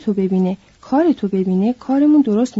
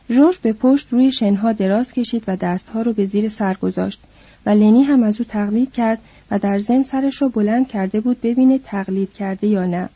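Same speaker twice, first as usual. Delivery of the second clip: plain reading voice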